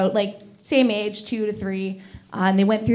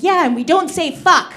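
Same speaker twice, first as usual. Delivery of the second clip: put-on higher and louder, a cheeky kid; proud toddler; 7 semitones higher, 7 dB louder than usual. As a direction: chirp it like a smug toddler. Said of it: "my niece is like".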